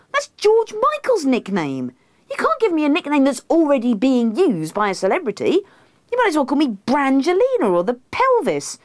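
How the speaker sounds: high-pitched indignant protest; affronted, getting carried away with outrage. A narrator's reading. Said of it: "Me, very indignantly".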